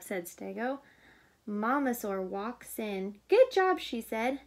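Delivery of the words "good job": higher and louder, bright praise; mother praising the tidy room. A narrator's reading. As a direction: cheer them on proudly. Mama praises.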